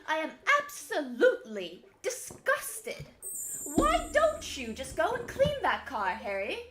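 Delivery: loud, slow, hammed-up posh English scold; English caricature. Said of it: "more of a caricature".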